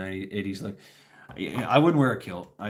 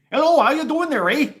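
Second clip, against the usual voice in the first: loud, high-pitched Midwestern caricature; high Midwestern accent; 7 dB louder than usual. Acted. Talking to folks in a high, loud Midwestern accent. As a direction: play a chirpy upper-Midwest local. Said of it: "a high Midwestern accent".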